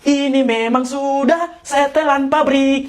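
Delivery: exaggeratedly measured speech; affected, overdone manner. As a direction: draw out the syllables as if stuck that way. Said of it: "Kau jangan main-main".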